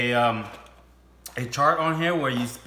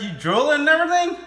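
louder, fast playful tease; amused teasing; 13 semitones higher, 6 dB louder, faster than usual. Real, not acted. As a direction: tease them with surprise.

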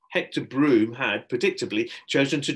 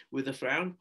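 plain reading voice

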